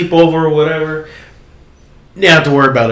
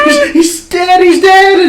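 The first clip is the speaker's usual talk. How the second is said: mock shocked; 5 dB louder, slower than usual. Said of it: "she's like".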